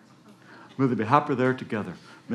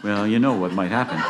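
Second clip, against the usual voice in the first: plain reading voice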